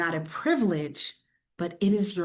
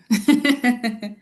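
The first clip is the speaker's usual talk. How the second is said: short laugh; 3 semitones higher, 4 dB louder than usual. Real, not acted. Laughing at own story.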